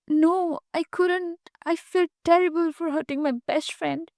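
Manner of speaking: trembling voice. A narrator's reading. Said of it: "her voice trembling".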